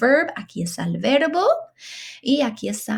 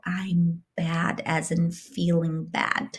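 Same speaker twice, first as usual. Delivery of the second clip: whisper; 5 dB quieter than usual. Real, not acted. Teacher glosses meaning softly.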